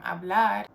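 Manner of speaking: hablar with the stress on the final "-lar"; stress on last syllable. Shown as a demonstration. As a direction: hit the second syllable of "hablar". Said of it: "falls on the last syllable".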